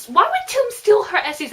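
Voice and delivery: high, loud disbelieving question; incredulous. Real, not acted. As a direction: blurt it out in disbelief. Reader incredulous at the plot.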